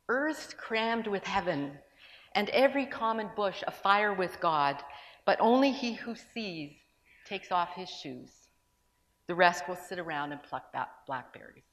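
slow, reverent reading of verse; poem recital. Acted. Preacher reciting Browning to the congregation, reverently. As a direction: let each line land, slowly. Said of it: "Elizabeth Barrett Browning says this".

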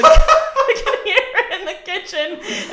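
high, slow, loud through laughter; laughing retelling. Retold or imitated. Woman at window retold, speaker laughing. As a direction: crack up while quoting the visitor.

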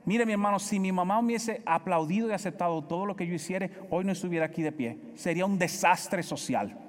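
normal talking voice

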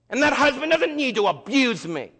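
loud, high indignant outburst; angry protest. Acted. Fed-up wife standing up against her husband, angry protest. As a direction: raise the voice in acted outrage.

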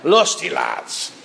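"Lusty lads" drawn out slowly in a put-on rustic accent; slow hammed-up accent on "Lusty lads". Shown as a demonstration. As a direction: savour "Lusty lads" slowly in a mock old-time accent. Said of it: "you almost feel like you have to say an accent".

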